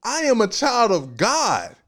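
high, loud, slow declaration breaking into a laugh; preaching climax. Acted. Preacher to the congregation, exultant. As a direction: proclaim who you are.

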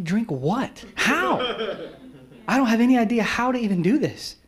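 put-on bewildered teen exclaiming; confused outburst. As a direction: blurt it out, totally lost. Confused boy reacting to prayer.

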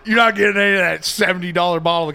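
loud, laughing send-off; mock scolding. Host shooing pretend visitor off the bourbon, mock scolding. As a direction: shout him away, half laughing.

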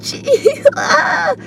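very high, soft squealing mock giggle; imitated laugh. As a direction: mimic a comic cracking up at his own joke. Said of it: "he would laugh a lot".

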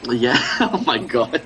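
usual speaking voice

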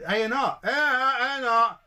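very high repeated chant; mock receptionist. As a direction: squeak the letters high again and again. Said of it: "I'll make her say".

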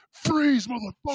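very high mock police yell; high-pitched imitation of a screamed order; imitated cop shout. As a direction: mimic a cop yelling at gunpoint. Cop aiming gun at him.

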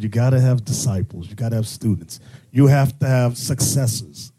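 ordinary delivery

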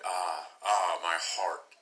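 understated sighing lament; restrained sigh of pain; narrated sigh. A narrator's reading. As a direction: sigh the words out, sinking. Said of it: "She sighed".

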